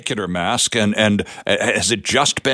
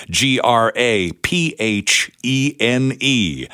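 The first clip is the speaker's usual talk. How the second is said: spelled out slowly; much slower than usual. Shown as a demonstration. Host spells the word for listeners.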